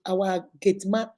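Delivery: ordinary talk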